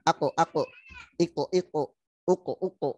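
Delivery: Ako, iko, uko: bounce the k and run straight on; bounce then join. Shown as a demonstration. Teacher models sukun letters for repeating, bounced. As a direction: bounce the stop and link at once. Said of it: "Setelah mantul, langsung sambung".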